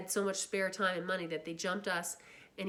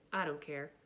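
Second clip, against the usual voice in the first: plain reading voice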